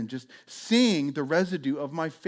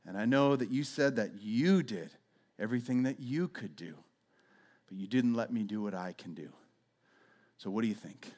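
gentle Jesus; 5 semitones lower, 5 dB quieter than usual. Retold or imitated. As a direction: kindly ask them what they think. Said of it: "Jesus looking at them going".

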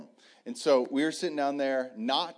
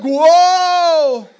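long, high, very loud whoa; excited scream; 17 dB louder than usual. Retold or imitated. Aiden reacting as he fights a fish, excited scream. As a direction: belt out a kid's amazed yell. Imitating other son screaming.

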